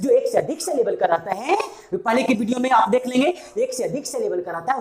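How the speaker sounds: normal talking voice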